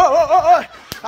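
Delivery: «oh» repetidos, agudos y fuertes; jaleo a saltos. Real, not acted. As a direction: jalea cada salto con un grito. Jalea los saltos de gacela. (exclamaciones rítmicas de ánimo)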